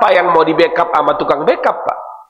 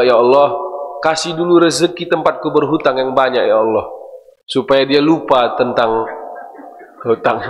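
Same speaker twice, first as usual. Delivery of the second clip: hushed prayer; 8 semitones lower than usual. Acted. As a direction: pray it gently and low. Acted prayer for creditor's wealth.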